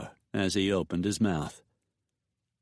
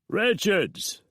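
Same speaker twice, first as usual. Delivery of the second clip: much higher, loud shout from a distance; calling from outside; 11 semitones higher, 3 dB louder than usual. A narrator's reading. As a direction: yell his name across the yard. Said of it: "The call came from outside the house".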